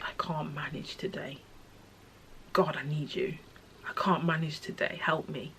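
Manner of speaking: soft, breathy near-whisper plea to God; hushed plea. Retold or imitated. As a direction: quietly beg for help, voice dropping away. Voicing own desperate prayer.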